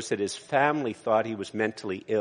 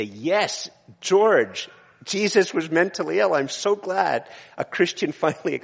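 higher and louder, a hammed-up smug atheist with a laugh; mock gloating atheist; 6 semitones higher, 4 dB louder than usual. Preacher acts out gloating atheist.

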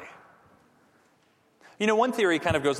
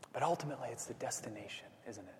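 hushed whisper; 5 semitones lower, 14 dB quieter, faster than usual. Real, not acted. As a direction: drop to a whisper on the key point. Sermon climax, hushed point.

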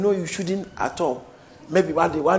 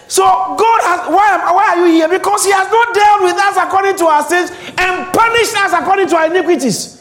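loud, high, fervent preaching; preaching at full force; 12 semitones higher, 7 dB louder than usual. Acted. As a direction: shout it from the pulpit.

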